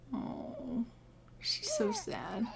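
soft pitying whisper; whispered sympathy for the mourning sim. Real, not acted. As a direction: breathe it out softly, full of pity.

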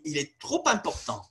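trop important linked with a sounded P, trop-P-important; liaison with the P. Shown as a demonstration. Teacher demonstrating a reappearing consonant. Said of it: "in some circumstances it might reappear".

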